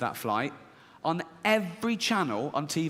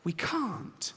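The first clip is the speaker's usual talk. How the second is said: timid inner voice; 9 semitones higher, much slower than usual. Acted. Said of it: "This one goes".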